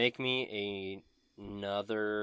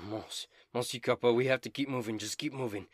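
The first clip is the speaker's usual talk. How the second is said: shivering; 3 semitones higher, 3 dB louder than usual. Acted. Dobin urges Monsieur Copper to keep moving, shivering. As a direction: urge them on through shivers. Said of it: "Dobin is shivering".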